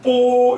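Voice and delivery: 뽀 held high and level; first tone. Shown as a demonstration. Teacher reading bo in the first tone, high and level. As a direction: say 뽀 high and flat like a Mandarin first tone. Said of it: "일성으로 읽을게요".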